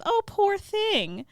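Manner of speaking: very high, slow cooing pity; loud, drawn-out commiseration; mock sympathy. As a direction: gush with put-on pity. Villain feigns pity for bereaved girl.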